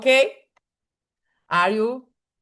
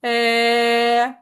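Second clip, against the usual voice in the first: sustained vowel demo; 4 dB louder than usual. Shown as a demonstration. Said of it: "and you touch your neck and you say".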